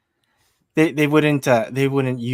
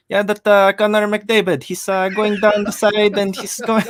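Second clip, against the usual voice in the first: put-on high-pitched Filipino-accented commentary; Filipino English accent; 8 semitones higher, 4 dB louder than usual. Imagined dubbed hockey show, play-by-play in a Filipino English accent. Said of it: "Filipino English accent".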